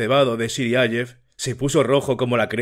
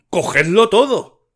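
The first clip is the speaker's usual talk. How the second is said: high, loud furious cry; father's loud outburst at the table; shouted in a changed voice; 8 semitones higher, 5 dB louder than usual. A narrator's reading. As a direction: yell it as if being robbed blind. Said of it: "gritó con voz alterada".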